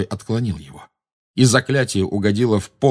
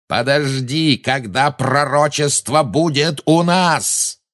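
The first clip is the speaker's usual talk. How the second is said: raised, stretched-out bellow; high, drawn-out commanding yell; roar; 7 semitones higher, 3 dB louder, slower than usual. A narrator's reading. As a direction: roar over the commotion. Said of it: "взревел Малфой".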